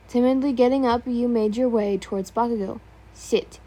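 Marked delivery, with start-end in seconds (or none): none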